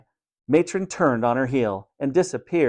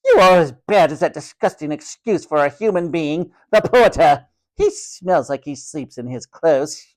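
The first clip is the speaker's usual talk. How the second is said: horrified disgusted matron; 5 semitones higher, 5 dB louder than usual. A narrator's reading. Matron horrified at boy sleeping in cricket kit, shrieking disgust. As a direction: recoil in haughty revulsion. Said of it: "Matron's lips curled in horror".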